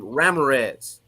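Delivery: Ramirez said as RAM-uh-rez; anglicized name pronunciation. Retold or imitated. Retired paratrooper's way of saying a colleague's name, Ramirez anglicized as RAM-uh-rez. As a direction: stress the first syllable of Ramirez, English vowels. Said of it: "used to always pronounce my name".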